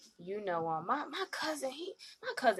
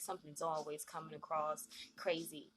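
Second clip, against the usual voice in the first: ordinary talk, nothing marked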